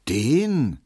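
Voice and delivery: incredulous contempt; scornful. A narrator's reading. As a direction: throw it out with contempt. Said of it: "meinte Hedwig verächtlich".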